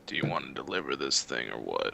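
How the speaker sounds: put-on laid-back gunslinger with an accent; stoner cowboy. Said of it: "Sounds like a stoner cowboy".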